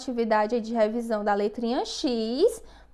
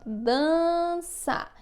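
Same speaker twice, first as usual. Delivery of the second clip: slow, held DANÇA, raised pitch; dictation; 4 semitones higher than usual. Shown as a demonstration. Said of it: "esse foi o ditadinho das palavras".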